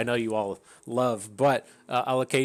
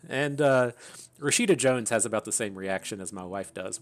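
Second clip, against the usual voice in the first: normal talking voice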